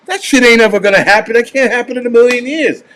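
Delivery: dismissive dad scoffing at the TV; imitating dad scoffing. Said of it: "he's like".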